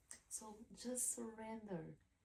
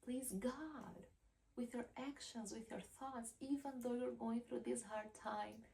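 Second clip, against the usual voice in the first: ordinary delivery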